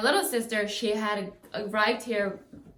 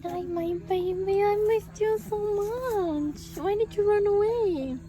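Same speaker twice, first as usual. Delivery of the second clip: high, drawn-out cooing to a dog; 11 semitones higher, slower than usual. Real, not acted. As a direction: coo slowly and high to your lost pet. Baby talk to the found dog.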